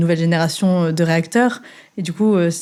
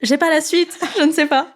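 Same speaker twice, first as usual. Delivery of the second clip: high-pitched, laughing imitation of someone stuck; stumped beginner; 9 semitones higher than usual. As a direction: mimic a lost language learner, voice up high. Imitating colleagues stuck after the greeting. (imitation amusée, perdu, voix aiguë)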